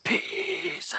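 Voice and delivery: high strained whispered shout; whisper scream. Acted. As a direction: shout it but under your breath. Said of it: "in the quietest voices we can muster".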